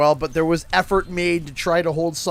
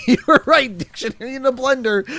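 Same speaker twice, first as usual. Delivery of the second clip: laughing it out; breaking up mid line; 7 semitones higher, 3 dB louder than usual. Real, not acted. Laughing back at a chat joke.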